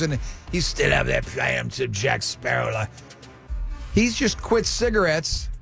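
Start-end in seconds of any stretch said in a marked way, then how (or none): none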